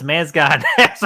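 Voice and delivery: ordinary talk, nothing marked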